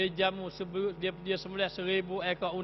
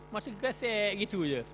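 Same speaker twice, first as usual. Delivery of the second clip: normal talking voice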